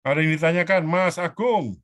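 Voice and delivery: real call-out for questions. Real, not acted. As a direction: raise the voice to reach a remote listener. Calling on online attendee for questions.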